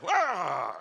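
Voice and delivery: a short high yelp of fright; mock scream. Son mimics mum screaming at the sight, high yelp. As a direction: yelp like a startled mother.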